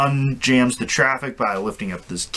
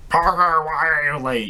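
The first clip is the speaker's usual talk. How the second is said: mock angry editor; 6 semitones higher than usual. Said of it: "Jameson's all mad".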